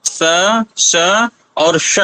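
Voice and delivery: स, श और श बोलकर तीनों का अंतर दिखाइए; तीनों सकार साफ़ अलग-अलग उच्चारित; three sibilants shown. Shown as a demonstration. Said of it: "तीनों स के बारे में मैंने बताया". (स, श, ष का भेद दिखाती हुई शिक्षिका)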